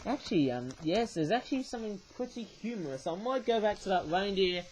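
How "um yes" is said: usual speaking voice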